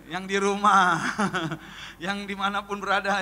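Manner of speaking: ordinary delivery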